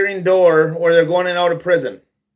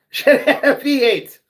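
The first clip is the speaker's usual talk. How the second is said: higher, repeating it through a laugh; 7 semitones higher than usual. Shown as a demonstration. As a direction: laugh at your own joke as you repeat it.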